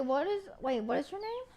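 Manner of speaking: ordinary talk, nothing marked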